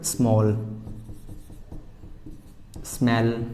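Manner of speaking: "स्मॉल" and "स्मेल" read carefully with s-m cluster; careful teacher reading "स्मॉल", "स्मेल"; model pronunciation. Shown as a demonstration. Teacher modelling "स्मॉल" and "स्मेल" for students. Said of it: "कैसे पढ़ा आपने?".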